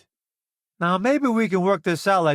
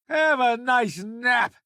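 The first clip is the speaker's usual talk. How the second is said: mocking taunt; 7 semitones higher, slower than usual. Taunt at a knocked-out enemy.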